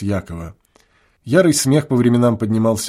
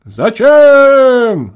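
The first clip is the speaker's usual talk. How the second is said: high, louder reluctant shout from afar; the far-off boy answering slowly, distant shout; distant call back; 12 semitones higher, 9 dB louder than usual. A narrator's reading. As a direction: call back lazily across the field. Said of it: "ответил тот спустя долгое время".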